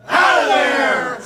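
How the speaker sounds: long, very loud shout; loud game-show elimination yell; shouted elimination call. Shown as a demonstration. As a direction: bellow it out to the crowd, drawn out. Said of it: "Try that with me".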